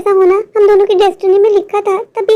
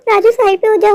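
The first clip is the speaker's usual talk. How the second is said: normal talking voice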